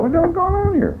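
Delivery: high, soft bewildered question; baffled inner voice. Retold or imitated. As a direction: wonder aloud in disbelief. Confused at man crying.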